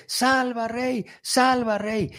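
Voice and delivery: high, pleading cry to the king; crowd's plea. Retold or imitated. People of Samaria begging their king, high pleading cry. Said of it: "así está gritando la gente".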